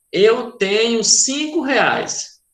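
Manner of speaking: ordinary talk, nothing marked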